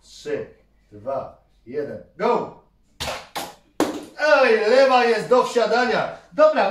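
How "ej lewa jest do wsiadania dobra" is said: louder, raised exclamation; loud call-out. Real, not acted. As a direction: call it out over the noise. Father calls out after last shot. (głośny okrzyk do syna)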